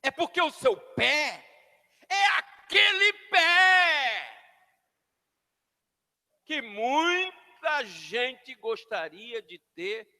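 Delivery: ordinary talk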